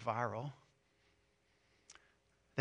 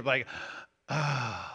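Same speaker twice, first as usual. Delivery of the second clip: demonstrated groan; 2 semitones lower than usual. Shown as a demonstration. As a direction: let out a weary groan. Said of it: "That's that, that's a groan".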